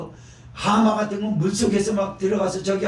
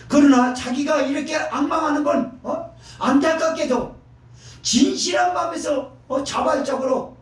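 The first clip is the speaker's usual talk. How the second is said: high, raised sermon cry; preaching at full force; 7 semitones higher than usual. Acted. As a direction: drive the point home from the pulpit. Preaching climax, shouted. (높고 힘찬 설교 외침)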